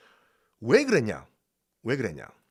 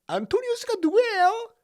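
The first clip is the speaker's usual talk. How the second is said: squeaky quiz question; 3 dB louder than usual. Shown as a demonstration. Quizzing the class.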